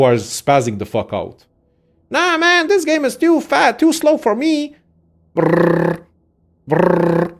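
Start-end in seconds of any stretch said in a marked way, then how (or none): 2.1-4.8 high-pitched squeaky mocking voice. Mocking the kind of player who wants faster games. 5.3-7.3 lip buzz. Retold or imitated. Imitating fast combat noise.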